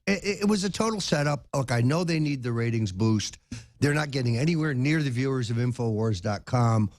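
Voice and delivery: ordinary talk, nothing marked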